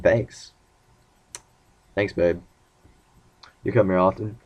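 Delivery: low, soft smooth-talker reply; deep flirty voice. Retold or imitated. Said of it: "My voice got all deep".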